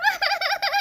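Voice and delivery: high, soft stuttering sobs; frightened sobbing. Acted. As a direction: sob in fear. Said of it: "ما تعيطيش".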